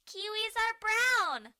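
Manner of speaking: high, loud excited gasp of discovery. Acted. Bluey telling Dad what she found, gasping with excitement. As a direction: blurt it out in delight. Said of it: "Bluey gasps".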